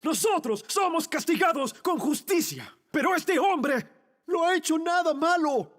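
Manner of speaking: high, strained protest; impassioned rebuke. Acted. Thief defending the innocent man, high impassioned rebuke. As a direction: call it out high to the other man. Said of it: "le dije a él".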